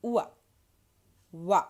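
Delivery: "oua" as oo gliding into ah, then "wa"; wa sound. Shown as a demonstration. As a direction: model "oua" and "wa" as short, separate syllables. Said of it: "give us a sound like".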